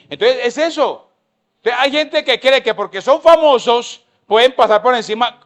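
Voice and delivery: loud, high preaching; impassioned sermon; fiery preaching. Acted. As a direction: drive the point home to the congregation. Preaching at full force.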